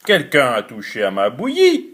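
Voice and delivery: low, heavy papa bear voice; deep growly big bear; big bear's gruff voice. Acted. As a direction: rumble it like a huge bear. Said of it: "de sa grosse voix".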